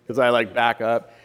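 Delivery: ordinary talk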